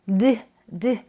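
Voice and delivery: "드" as a short voiced d sound; voiced d ending. Shown as a demonstration. Teacher warning learners off one catch-all ending, voiced "드". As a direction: say "드" as a bare d. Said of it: "발음해 주시면 안 되고요".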